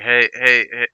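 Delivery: put-on thick Southern accent. Old vet hailing a teenager, thick Southern accent. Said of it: "had, like, an extremely thick Southern accent".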